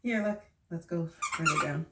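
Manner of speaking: ordinary delivery, nothing marked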